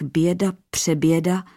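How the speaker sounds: ordinary delivery, nothing marked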